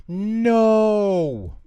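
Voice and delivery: very high, loud shouted refusal; group yell. Acted. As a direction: everyone yelling no at once. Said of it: "all yelled".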